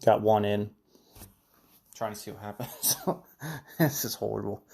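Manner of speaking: talking with a cough drop in; garbled through a cough drop; mouth full. Real, not acted. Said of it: "chewing my cough drops".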